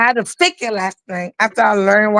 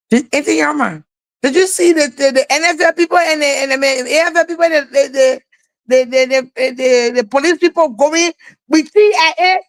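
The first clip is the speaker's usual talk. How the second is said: put-on higher, fast, eager child voice stumbling over words; imitating a little kid; 6 semitones higher than usual. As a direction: play the excited little boy tripping on "the, the". Little kid asks about soldiers.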